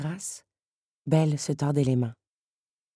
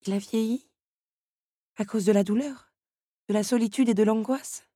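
put-on worried young woman; 6 semitones higher than usual. A narrator's reading. Belle worrying about her father.

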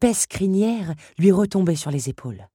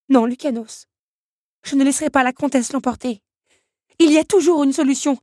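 higher, louder, fast resolve; determined defiance; 9 semitones higher, 5 dB louder than usual. Acted. Belle tells Lucanos she won't let the countess win, determined. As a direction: push through it, refusing to give up.

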